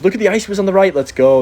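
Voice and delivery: high, loud hype burst; excited cheer. Real, not acted. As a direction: cheer the play on, pitched right up. Cheering a big hit in game.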